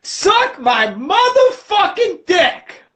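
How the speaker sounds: slow, high yell; mock Jesus shout. Jesus yelling at the hypocrites, slow shout. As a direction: bellow it out slowly at them. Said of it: "he's like".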